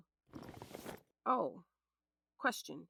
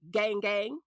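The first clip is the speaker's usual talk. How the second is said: louder cocky chant-like shout; defiant boast; 3 semitones higher, 7 dB louder than usual. Acted. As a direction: punch it out with swagger. Defiant boast after speech.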